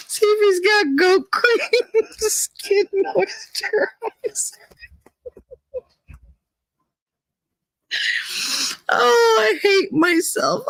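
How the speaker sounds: high, slow, crying with laughter; can barely get it out for laughing; helpless tearful laughter; laughing to tears. Real, not acted. Laughing till crying at chat.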